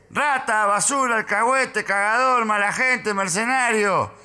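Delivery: high-pitched yelled string of insults; retold shouting. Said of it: "me grita".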